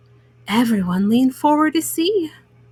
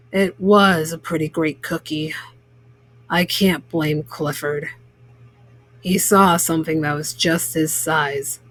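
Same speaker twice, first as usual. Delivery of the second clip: lower, measured baker forgiving the dog; said slowly; 9 semitones lower than usual. A narrator's reading. Baker forgiving the dog, lower and measured. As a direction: think it over and let it go. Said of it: "Mister Bleakman said slowly".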